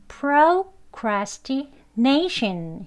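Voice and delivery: procrastination very slowly, strongest beat on NAY; stress before -tion. Shown as a demonstration. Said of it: "강세가 이 션 바로 앞에 있습니다". (procrastination을 천천히, 션 앞 '네이'에 강세)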